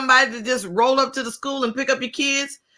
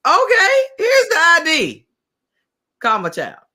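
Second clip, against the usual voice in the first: higher, slow exasperated parent; impatient parent voice; 7 semitones higher than usual. Retold or imitated. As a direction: parent hands over ID to staff, impatiently. Parent hands over ID.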